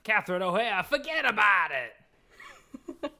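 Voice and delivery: put-on loud, high, drawn-out New York accent; thick New York accent. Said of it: "like, a thick New York accent".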